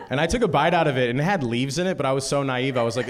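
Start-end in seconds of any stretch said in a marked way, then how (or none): none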